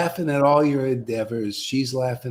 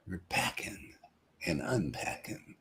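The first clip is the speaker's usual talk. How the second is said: whisper; 8 semitones lower, 14 dB quieter than usual. Shown as a demonstration. Joking aside to the room.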